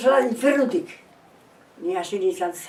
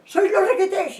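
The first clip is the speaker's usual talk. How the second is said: pitched up, imitating a call; high retold call; 10 semitones higher, 4 dB louder than usual. Retold question called to soldiers. (llamada aguda imitada)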